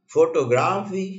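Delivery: Photography with ग्रा stressed and lengthened; stress on third syllable. Shown as a demonstration. Teacher showing four ways to say a word, stressing ग्रा in photography. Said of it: "मैं चारों तरह से शब्द बोल सकता हूँ".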